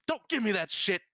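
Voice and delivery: high, loud scolding; angry snap. Acted. Darla berating Dave as he comes in, loud angry snap. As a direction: cut him off with a furious yell.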